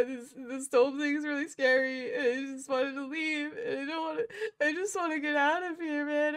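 high, loud, tearful pleading as a terrified thug; sobbing scared villain. Acted. Terrified villain sobbing his apology to the hero. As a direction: blubber out an apology, voice cracking high. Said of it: "and he's like".

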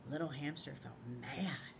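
hushed whisper of the feeling; whispered narration. A narrator's reading. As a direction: breathe the line like a secret.

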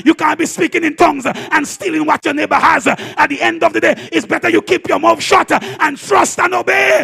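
loud, fired-up sermon voice; full-force preaching. Acted. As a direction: preach it to the house at full volume.

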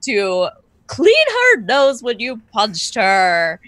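put-on cartoon dog voice, swooping pitch; Snoopy. Thrown voice behind his ear, cartoon dog explaining. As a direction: ham it up as a talking cartoon dog. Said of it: "like a Snoopy voice".

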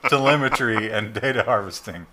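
laughing through the words; can't keep a straight face. Real, not acted. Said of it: "We can't keep a straight face".

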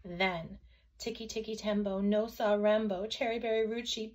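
ordinary delivery, nothing marked

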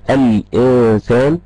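الإنسان with the still n clearly sounded; slow teacher's demo of the n in الإنسان; sounded still noon. Shown as a demonstration. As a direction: draw out الإنسان, letting the vowelless n ring. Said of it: "نون ساكنة مكتوبة قدامي وبنطقها".

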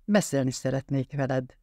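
plain reading voice

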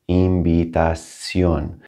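invitación drawn out slowly for a learner; slow, stretched Spanish invitación. Shown as a demonstration. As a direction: say invitación at half speed, syllable by syllable. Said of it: "You can slow these down at first".

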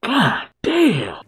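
GODDAMN pushed up high; emphatic exclamation. Real, not acted. Viewer wowed by a sexy character, high emphatic "goddamn".

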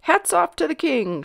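loud, high proclamation; ringing captain's order, pitched high; captain's shout. A narrator's reading. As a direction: call it out over a crowd. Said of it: "shouted the captain".

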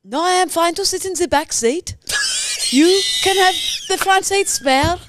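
put-on high-pitched Swedish accent; Swedish backpacker. Backpacker to suspicious driver, high Swedish accent. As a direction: play a wary Swedish hitchhiker. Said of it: "Swedish backpacker that's just been picked up".

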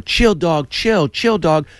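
high, urgent retold plea; mock pleading. Comic plays the beaten man pleading to stop, high and urgent.

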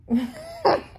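sudden high squealing laugh. Real, not acted. Laughing at her own look.

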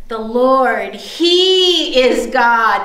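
slow, stronger proclamation with long-held HE; crowd's repeated declaration. Retold or imitated. People on their knees repeating their confession, slow and emphatic. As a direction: draw out HE and proclaim it fully. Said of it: "They just kept saying that over and over again".

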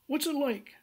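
high, loud, put-on pompous lawyer voice; mock posh caller, pitched high; a caller's high voice. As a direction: ham up a self-important lawyer. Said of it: "And he said".

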